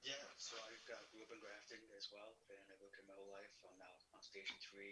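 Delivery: faint distant audio, far from mic; barely audible. Real, not acted. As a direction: voice lost in a bad connection. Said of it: "I can barely hear you in your audio".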